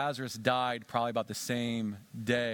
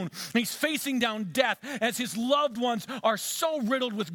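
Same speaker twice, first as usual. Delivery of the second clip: fiery sermon, higher and louder; preaching at full force; 11 semitones higher, 4 dB louder, slower than usual. Real, not acted. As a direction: build the fury of the war horse.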